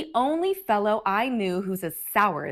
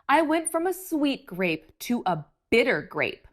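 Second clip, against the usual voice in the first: ordinary delivery, nothing marked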